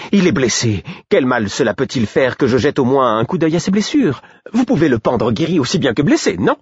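véhémente et aiguë; indignée. Acted. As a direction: protester avec véhémence. Patience pleads with guard. (ton véhément, indigné)

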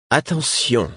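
voix haute, ton d'avertissement; dragonne menaçante. A narrator's reading. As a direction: avertir avec autorité. Dragon threatens to drop him. (mise en garde menaçante)

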